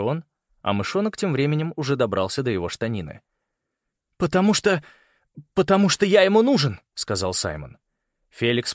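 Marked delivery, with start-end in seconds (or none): 4.2-6.8 high, halting then insistent; stammered, high-pitched argument for your life; urgent plea. A narrator's reading. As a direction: blurt out a desperate justification. Boy pleads for his life.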